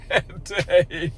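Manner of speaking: words dissolving into laughter; laughing. Real, not acted. Retelling a flimsy excuse, laughing. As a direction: break into a laugh through the words. Amused by the excuse she reports.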